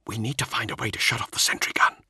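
tense unvoiced whisper; urgent whisper. Whispered planning.

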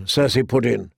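usual speaking voice

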